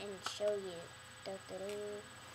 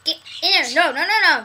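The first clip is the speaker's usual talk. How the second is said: shouting; 4 semitones higher, 12 dB louder, much faster than usual. Real, not acted. Shouting at bird.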